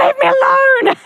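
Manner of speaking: high, loud mock cry of being fed up; mock exasperated outburst. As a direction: squeal it out like a hassled celebrity. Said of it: "I'd be like".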